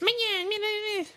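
very high cartoon Minion chirp. Shown as a demonstration. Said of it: "What noise does an Uber or Minion make?".

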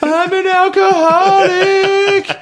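very high, drawn-out comic wail; mock crying. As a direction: imitate yourself bawling for mom from bed. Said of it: "I just instantly started crying".

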